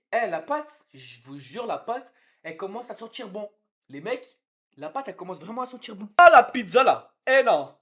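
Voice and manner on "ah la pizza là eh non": very loud, high outcry; alarmed yell. Real, not acted. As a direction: yell out as the pizza goes wrong. Cries out over the pizza.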